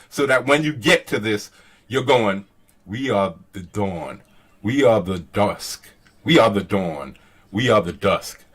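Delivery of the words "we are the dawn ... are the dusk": low, measured dramatic recital; separated phrases. Shown as a demonstration. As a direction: set "We are the dawn" and "We are the dusk" apart with pauses. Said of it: "why you separate it like this".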